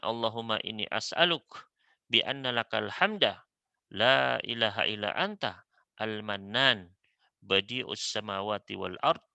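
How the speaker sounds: ordinary delivery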